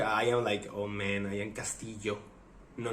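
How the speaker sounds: ordinary talk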